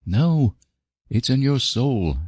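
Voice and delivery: earnest, measured and a little drawn out; grave. A narrator's reading. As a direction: explain it gravely to a child, slowly. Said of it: "gravity must be preserved".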